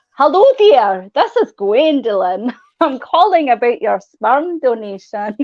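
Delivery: slow, hammed-up Scottish prank caller; Scottish accent. Woman prank calling boys as a made-up caller, dodgy Scottish accent. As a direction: draw it out in a put-on Scottish accent. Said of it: "I used to do a banging Scottish accent".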